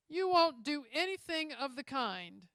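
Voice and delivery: loud, high, authoritative order; a judge's commanding command. A narrator's reading. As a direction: stop them cold with authority. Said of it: "said a commanding voice".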